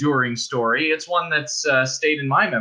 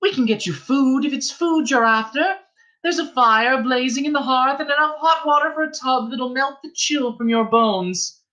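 friendly innkeeper's wife; 13 semitones higher than usual. Acted. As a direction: coax the girl in from the rain. Innkeeper's wife offers food, fire.

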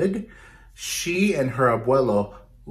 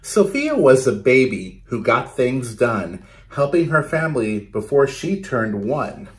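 put-on lively, rhythmic read-aloud for kids; storytelling to children; 6 dB louder than usual. Teacher reading a picture book to her class, lively read-aloud. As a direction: bounce the rhymes for a class of children.

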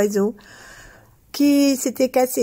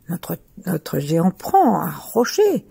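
plain reading voice

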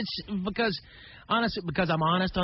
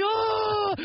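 a teen's half-hammed wail of despair; pitched-up mock sob; crying out. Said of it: "now I'm crying".

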